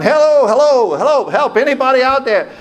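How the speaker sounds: high-pitched calling out; hollering for help. Retold or imitated. Passenger on radio with pilot out cold, high urgent hollering. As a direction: holler into the radio for anyone at all. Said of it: "he's hollering".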